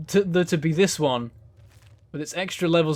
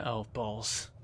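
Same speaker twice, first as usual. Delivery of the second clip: softer, lower muttered oh balls; under the breath; muttered dismay; 7 semitones lower, 13 dB quieter than usual. Real, not acted. Muttered reaction to a threat.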